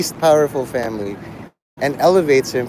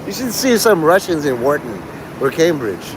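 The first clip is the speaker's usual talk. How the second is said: ordinary talk, nothing marked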